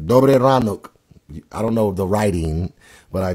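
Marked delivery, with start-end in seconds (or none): 0.1-0.9 bright, loud Ukrainian good morning; said with some care in Ukrainian; Ukrainian greeting. Shown as a demonstration. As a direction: call it out to the Ukrainian viewers. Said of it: "speak Russian and Ukraine".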